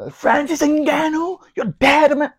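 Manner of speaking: high, loud mock English accent taunt. Imitating Tyson Fury taunting Ngannou in a mock English accent. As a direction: put on an English accent and call him out. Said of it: "avec ton accent anglais".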